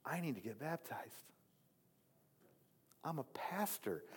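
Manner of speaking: pastor's inner voice, softly torn in the tank; hushed inner voice. Retold or imitated. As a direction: say it under your breath to yourself. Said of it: "and I'm like".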